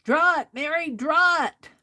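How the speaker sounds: egging herself on in a loud high voice; mock urging voice.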